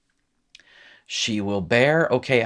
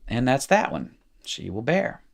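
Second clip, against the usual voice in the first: usual speaking voice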